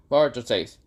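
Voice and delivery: ordinary delivery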